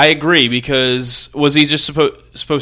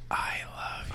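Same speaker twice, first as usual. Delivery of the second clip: faint breathy whisper; creepy whisper of love; 20 dB quieter, faster than usual. Retold or imitated. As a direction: breathe it out with almost no voice. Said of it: "because he said".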